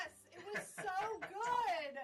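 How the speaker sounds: loud, slow gushing praise; excited. Real, not acted. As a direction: rave about it. Raves about the beer.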